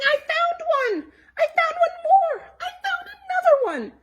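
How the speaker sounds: put-on very high, loud, laughing kid shouts; kids shouting. Kids at the egg hunt shouting with laughter, very high. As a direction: squeal like happy children. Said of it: "kids were shouting".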